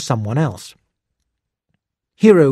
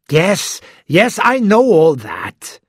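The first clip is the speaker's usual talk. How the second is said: louder, higher, slow exasperated brush-off; impatient; 6 semitones higher, 4 dB louder, slower than usual. A narrator's reading. Detective interrupting Hiro, impatient and louder. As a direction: wave it away, fed up. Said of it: "the detective said impatiently".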